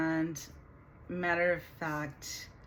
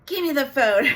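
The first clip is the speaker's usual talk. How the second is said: loud, higher retold demand from her daughter; snatch the phone back in a mock outburst; upset demand; 8 semitones higher, 11 dB louder than usual. Retelling her upset daughter demanding the phone, loud. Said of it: "She was so upset".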